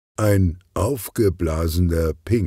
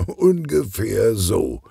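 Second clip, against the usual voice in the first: slow, higher, with a slight smirk; amused; 5 semitones higher, slower than usual. A narrator's reading. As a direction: say it through a faint smile. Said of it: "schmunzelt Kugelblitz". (schmunzelnd, gedehnt)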